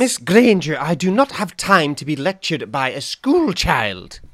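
loud, high, indignant teacher's rebuke; McGonagall. Acted. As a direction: put the pupil down sternly, loudly and slowly. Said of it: "straight into the mind of McGonagall".

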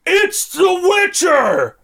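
high, drawn-out cry of alarm; mock alarm shout. Guards spotting the intruder aboard the flagship, mock alarm shout. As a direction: call it out high, stretching the words.

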